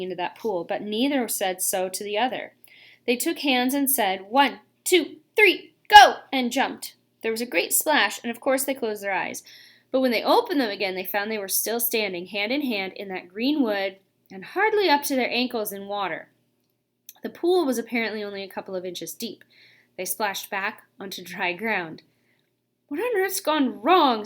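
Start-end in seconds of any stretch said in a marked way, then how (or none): 4.3-6.2 rising countdown that bursts on go; counting down. A narrator's reading. Counting down before jumping together. 22.9-24.2 mildly frightened. A narrator's reading. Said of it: "said Polly in a frightened voice".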